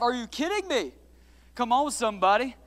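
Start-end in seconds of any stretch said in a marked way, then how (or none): none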